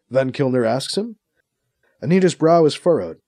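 usual speaking voice

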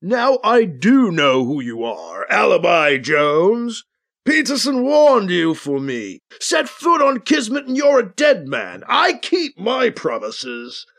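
slow, drawn-out villain voice rising into a threat; Lava Lure. A narrator's reading. Lava Lure threatens Alibi Jones, in a slow menacing character voice. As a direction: put on a menacing villain, dragging the words. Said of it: "Lava Lure shouts".

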